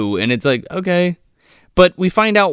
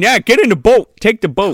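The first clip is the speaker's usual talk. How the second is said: louder, higher imitation of orders; mock urging; 5 semitones higher, 5 dB louder than usual. Host mocking the characters' repeated boat orders, mock urging. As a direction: bark it as a parody command.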